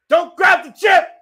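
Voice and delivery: loud, high urgent yell; shouting a warning. Real, not acted. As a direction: warn him at the top of your voice. Yelling at wrestler on screen.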